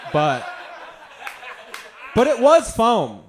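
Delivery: emphatic shout. Real, not acted. Comic to audience, shouting the correction. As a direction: belt out the punchline. Insisting on the foam.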